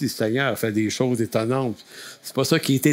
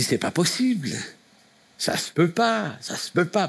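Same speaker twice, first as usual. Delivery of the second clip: imitated, higher French accent; lightly exclaimed in a put-on French accent; French-from-France accent; 4 semitones higher than usual. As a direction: mimic her very French accent in a higher pitch. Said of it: "elle avait un accent très français".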